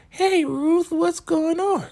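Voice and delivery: very high sugary greeting; sweet Joan. Retold or imitated. Joan answering Ruth, very high sugary voice. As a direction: chirp it way up high, all innocent. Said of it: "Joan comes over all like".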